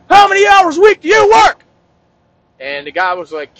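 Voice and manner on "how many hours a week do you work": high, loud gym-nut bellow; yelling. Acted. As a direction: yell it at a stranger out of nowhere. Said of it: "yelling at people".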